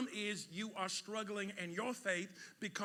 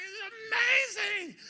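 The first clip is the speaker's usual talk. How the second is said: high, loud, slow cry of wonder; amazed crowd; 13 semitones higher, 10 dB louder, much slower than usual. Retold or imitated. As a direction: gasp it out like a thrilled onlooker. Crowd cheering the tightrope walker.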